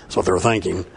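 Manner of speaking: normal talking voice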